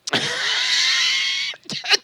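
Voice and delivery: high squealing laugh; burst of laughter. Real, not acted. Laughing at Jimmy losing on the radio, high squeal. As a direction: crack up hard.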